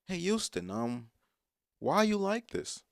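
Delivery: high-pitched mock questioner; playful high-voiced viewer question; imitated viewer. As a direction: put on a high voice for a puzzled commenter. Voices a viewer asking him.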